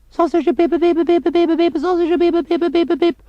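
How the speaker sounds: loud, high-pitched mimicked beeps; vocal beep jingle. Newsreader separates items with a loud, high beeping jingle. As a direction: squeal out a news jingle in beeps. Spoken jingle before news bulletin.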